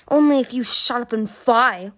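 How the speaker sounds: loud, irritated growl. A narrator's reading. Winter to Kipley as they race, growled. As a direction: growl it with mild force. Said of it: "Winter growled".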